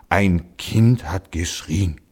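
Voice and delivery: slow, puffed-out words; snorting ox. A narrator's reading. Old ox snorts to the donkey about the cry, slow and puffing. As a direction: breathe it out heavily like an old ox. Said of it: "schnaufte der Ochse".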